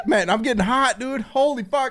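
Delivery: ordinary talk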